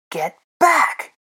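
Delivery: loud, high shouted command; fierce loud order; roaring. A narrator's reading. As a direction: roar to drive them off. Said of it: "he roared at the creatures".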